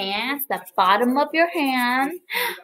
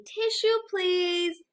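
higher, soft tissue please; polite request; 7 semitones higher, 6 dB quieter, slower than usual. Shown as a demonstration. Teacher models asking for tissue, soft and sweet. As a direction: ask sweetly like a child would.

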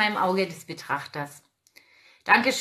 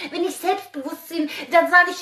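ordinary delivery

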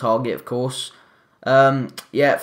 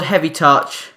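ordinary delivery

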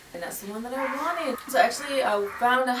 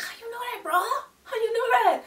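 very high-pitched mock surprise; retold astonishment; 14 semitones higher than usual. Woman retelling her reply to a stranger who guessed Georgia, astonished. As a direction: squeak it way up high, acting amazed.

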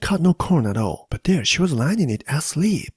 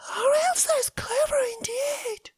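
high whisper of wonder; whispered awe; 6 dB quieter than usual. Acted. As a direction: breathe it in amazement. Maid marvels, half whispering.

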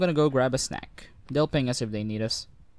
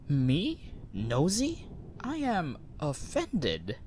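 mock offended; 3 semitones higher, 4 dB quieter, much slower than usual. Jokingly offended at being called nosy.

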